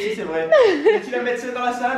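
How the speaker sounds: high, giggling joke; laughing. Real, not acted. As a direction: ask it while laughing.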